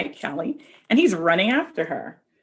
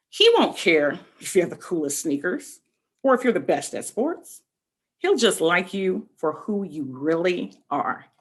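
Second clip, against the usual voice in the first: storybook reading; 3 semitones lower than usual. A narrator's reading. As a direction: read the picture book to the kids, unhurried. Reads picture book aloud.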